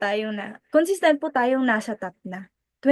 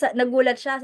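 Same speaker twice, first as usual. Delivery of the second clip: ordinary talk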